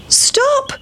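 put-on very high-pitched boys' call; storybook shout. A narrator's reading. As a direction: call out high as little boys. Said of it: "shouted the seven little boys".

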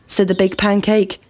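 ordinary delivery

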